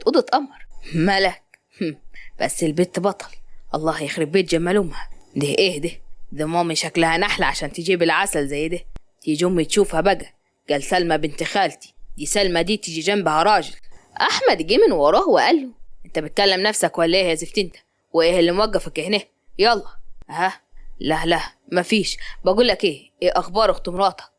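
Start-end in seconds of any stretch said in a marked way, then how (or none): 0.9-13.7 low male voice muttering to himself; a guy dazzled after meeting a girl; smitten young man. Acted. Zein alone, low, marvels at Malak. 16.1-19.8 low male voice, teasing; friend teasing. Acted. Ahmed teases Zein from behind, low and joking. 20.3-24.2 low male voice muttering to himself; a guy dazzled after meeting a girl; smitten young man. Acted. Zein alone, low, marvels at Malak. Zein startled, changes subject.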